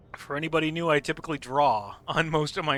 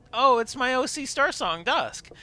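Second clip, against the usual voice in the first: high, louder retold reply; polite cover-up; 10 semitones higher, 5 dB louder than usual. Correcting a stranger about the plush, high innocent voice. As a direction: pitch it up, all innocent.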